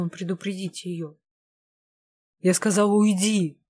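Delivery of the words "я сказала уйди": loud furious order to leave; angry yell at her husband to get out; angry shout. A narrator's reading. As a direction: snap it at him, shouting. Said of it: "зло выкрикнула она".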